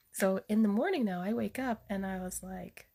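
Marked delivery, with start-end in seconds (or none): none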